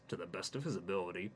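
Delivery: plain reading voice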